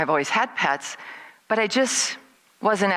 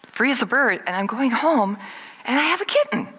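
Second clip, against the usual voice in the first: mounting disbelief; 7 semitones higher than usual. Acted. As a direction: build to a high, incredulous finish. Replays disbelief while driving home.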